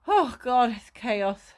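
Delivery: ordinary delivery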